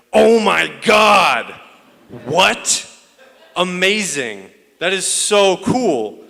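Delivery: high, loud, slow gushing Bostonian; gushing excitement. Retold or imitated. Mocking Boston friends gushing over Cuban roots, loud and high. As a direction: overdo the wide-eyed amazement.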